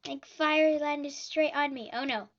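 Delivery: normal talking voice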